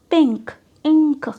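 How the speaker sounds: पिंक, इंक said slow and loud, nasal ं before a crisp क; demo words. Shown as a demonstration. Teacher to class, sounding out the words slowly and loudly. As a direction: stretch पिंक and इंक out for learners. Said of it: "एक consonant sound है".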